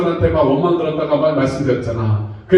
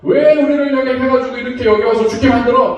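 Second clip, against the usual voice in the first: high-pitched grumbling protest; acted resentful complaint; 5 semitones higher than usual. Israelites blaming Moses at the sea, acted complaining. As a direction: protest in a raised pitch, imitating an aggrieved crowd. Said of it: "하면서 또 원망, 불평을 합니다".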